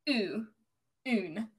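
oon said with a long ooh vowel; Elvish oon with long u. Shown as a demonstration. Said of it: "so it's".

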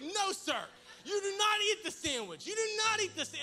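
high, loud, furious telling-off; scolding shout. Retold or imitated. Owner catches dog that ate his sandwich, hammed-up scolding shout. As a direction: yell it at a disobedient dog.

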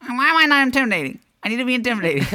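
high, fast mocking impression of a friend; put-on high whine at another frequency; mocking Shamir. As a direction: imitate him in a loud, high-pitched voice. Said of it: "I don't sound like that".

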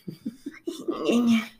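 high effortful strain noise; strained struggle grunt. Real, not acted. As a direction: grunt while pulling hard. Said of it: "That's the sound of struggle".

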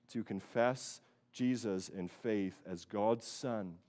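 ordinary talk, nothing marked

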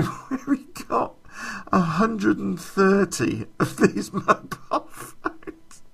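laughing so hard the words barely get out; cracking up mid-sentence, slowed by laughs; helpless laughter. Real, not acted. Telling the story, dying of laughter at the mobile phones. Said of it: "I'm dying".

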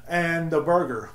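normal talking voice

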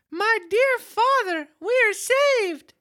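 high, slow, excited puppet voice; joyful wooden puppet boy; jerky creaky wooden puppet. Acted. Reads Pinocchio's lines.

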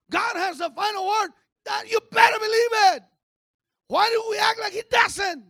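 high, loud preacher's shout; booming sermon declaration, pitched high; full-force preaching. Acted. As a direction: proclaim it loudly to the congregation.

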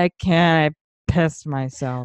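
slow mock drunken confession; hammed-up drunk whine; whiny drunk. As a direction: imitate a miserable drunk, dragging the words. Acts her drunk self confessing.

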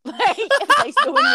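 high laugh through the words; words breaking into laughter. Real, not acted. Laughing through a joke line.

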